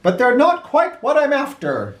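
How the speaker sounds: high, booming, pleased voice; satisfied president. Acted. President praising Big Ed's ribs, high and hearty. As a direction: savour it, then let him down gently. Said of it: "wiped his face with a napkin and said".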